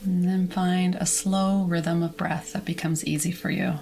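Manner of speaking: calm, measured meditation voice; guided relaxation. Acted. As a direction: guide listeners gently through releasing tension, with long pauses. Leads whole body relaxation.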